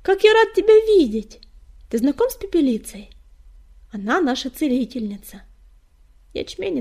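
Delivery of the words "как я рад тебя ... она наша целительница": high, loud delight, easing slightly; bursting with pleasure, then settling; joyful greeting. A narrator's reading. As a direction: greet an old friend gladly. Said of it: "радостно поздоровался он".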